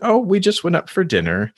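high, sweet, innocent tone; innocent excuse. Retold or imitated. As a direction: play it breezy and innocent. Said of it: "And she said".

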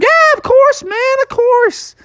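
very high, welcoming groundhog voice; Punxsutawney Phil. Acted. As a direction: say yes eagerly as the tiny groundhog. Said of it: "Punxsutawney was like".

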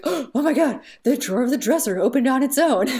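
high, loud startled cry; panicked hotel guest filming; frightened gasp. Retold or imitated. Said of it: "they're like".

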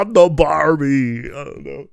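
ordinary talk, nothing marked

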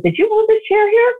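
incredulous husband asking; baffled question. Retold or imitated.

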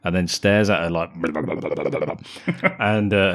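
usual speaking voice

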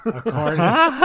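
high laughing echo. Real, not acted. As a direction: laugh the word out high and loud. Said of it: "that's hilarious".

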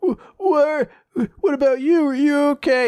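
high, stammering mock worry; imitated tearful worry. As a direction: play a tearful kid checking on someone. Voices tearful kid asking after others.

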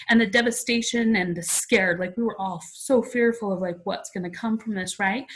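ordinary delivery

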